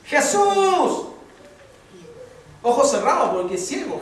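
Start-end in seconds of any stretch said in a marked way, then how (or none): 0.1-1.1 high, loud drawn call; crying out. Retold or imitated. Blind man calling to Jesus as He passes, crying out. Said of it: "dice que dio voces diciendo".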